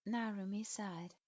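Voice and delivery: ordinary delivery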